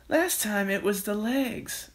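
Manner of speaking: high, slow character voice. Acted. Missus Cranky grumbling about the chicken, high slow complaint.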